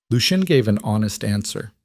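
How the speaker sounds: plain reading voice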